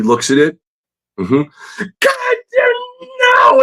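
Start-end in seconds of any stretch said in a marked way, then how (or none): 2.0-3.6 much higher, strained cry of fury; enraged teacher outburst. Retold or imitated. Teacher seeing the student's redrawn page, enraged cry. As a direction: erupt again at the redrawn page. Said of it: "And he smashed the table".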